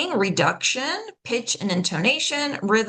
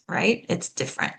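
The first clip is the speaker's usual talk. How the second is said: usual speaking voice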